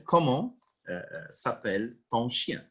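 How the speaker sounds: ordinary talk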